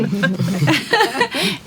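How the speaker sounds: risada curta e alegre, mais aguda. Real, not acted. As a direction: ri com gosto da convidada. Riso após apresentação da Renata. (risada alegre e aguda)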